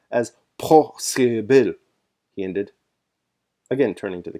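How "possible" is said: possible drawn out very slowly and louder, each syllable weighed; slow, heavy stress on possible to close the argument; dwelling on possible. Acted. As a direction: stretch out possible heavily. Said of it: "He dwelt particularly on the word possible".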